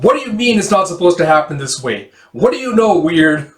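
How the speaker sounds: high, loud indignant outburst; acted furious challenge, pitch leaping up; angry confrontation. Gale grabs and confronts Weird.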